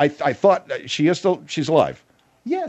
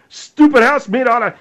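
ordinary delivery

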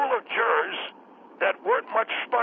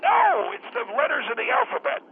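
exasperated shout. Acted. Exasperated correction in argument.

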